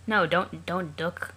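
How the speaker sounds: ordinary talk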